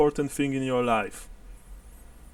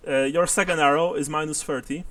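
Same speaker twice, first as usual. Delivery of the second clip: ordinary talk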